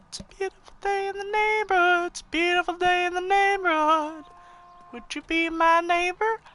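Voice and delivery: high singsong. Shown as a demonstration. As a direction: sing it up high like a kids' show host. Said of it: "the zombie voice".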